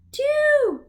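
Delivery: high delighted count; excited count. Real, not acted. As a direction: announce the count with joy. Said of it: "how many syllables".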